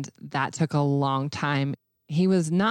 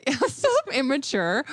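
laughing; 8 semitones higher than usual. Real, not acted. Laughing about her arms going limp.

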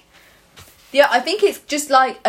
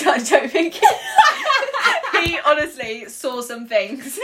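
higher words broken by real laughter; laughing; 8 semitones higher than usual. Real, not acted. Laughing to her friend about the stretchy toy. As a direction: giggle through it.

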